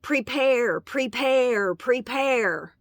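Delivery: pilot yelling over intercom. Retold or imitated. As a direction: yell it like a pilot on the PA. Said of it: "he was yelling into the speaker".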